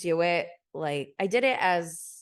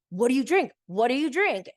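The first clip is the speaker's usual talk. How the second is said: higher, pestering mock interrogation; mocking questioning; 5 semitones higher, 4 dB louder than usual. Retelling how he kept saying it to her, mocking. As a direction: imitate someone needling, pitched up. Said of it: "making fun of me for not drinking".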